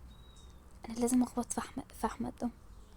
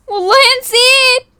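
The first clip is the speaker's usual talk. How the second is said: high, loud protest; panicked, high-pitched outburst; defensive cry; 9 semitones higher, 8 dB louder than usual. Acted. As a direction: wail the excuse loudly. Caught eating in Ramadan.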